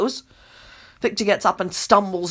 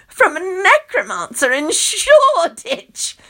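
shrugging; 8 semitones higher, 3 dB louder, slower than usual. A narrator's reading. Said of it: "Loretta shrugs".

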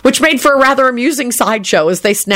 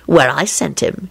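repeated insolent reply; 2 semitones lower, slower than usual. A narrator's reading. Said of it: "he repeated".

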